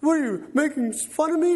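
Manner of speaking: high mock-indignant wife voice; offended wife. Wife challenging her joking husband mid-argument, mock indignant. Acts out wife's angry reply.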